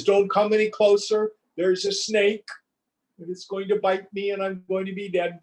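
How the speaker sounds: a king gasping out a warning, terrified; panicked warning. Acted. Panicked king warns servants.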